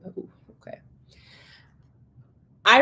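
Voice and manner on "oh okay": whispered, a bit deflated. Retold or imitated. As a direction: breathe it out under your breath. Reacts to curt reply.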